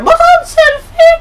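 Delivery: put-on very high, loud squeaky voice for the selfie girl; mock girly squeal. Reacting to the video, squeals as the selfie-taking girl, very high and loud. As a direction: squeal it like a giddy girl grabbing her phone.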